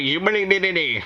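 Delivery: high sing-song Irish pirate voice; pirate Irish accent. Retold or imitated. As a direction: squeak it out like a cartoon Irish pirate. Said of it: "like, a pirate, pirate Irish accent".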